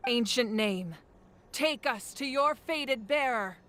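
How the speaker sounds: slow, measured ritual chant to the heavens; solemn invocation. Acted. Priestess calling the ancient name to its bearer, solemn and slow. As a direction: intone it like a sacred rite.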